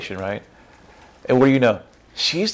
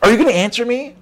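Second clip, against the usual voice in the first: pushy questioner; 11 semitones higher, 6 dB louder, faster than usual. Retold or imitated. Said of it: "you look at him and smile".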